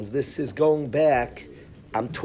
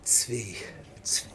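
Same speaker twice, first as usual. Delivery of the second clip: soft unvoiced plea; urgent whisper; 4 semitones lower, 10 dB quieter than usual. Narrator pleading with Tzvi by the van, whispered. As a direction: hiss his name to get his attention.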